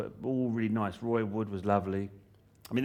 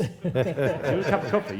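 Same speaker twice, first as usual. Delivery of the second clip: higher and louder, laughing; hammed-up cheery runner, much higher; mock chirpy offer; 7 semitones higher, 6 dB louder than usual. Mock editing room offer.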